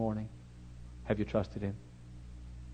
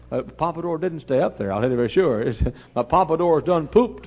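ordinary talk, nothing marked

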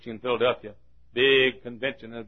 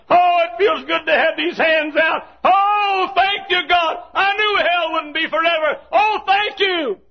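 overjoyed relief; 8 dB louder than usual. Acted. Dives thanking God for release from hell, rising jubilation. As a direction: build gratitude into an ecstatic shout.

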